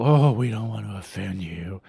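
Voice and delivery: mock-meek voice of a church that won't offend; mocking timid churchgoer. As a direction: sneer it as a spineless believer. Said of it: "Bow down and, and grovel".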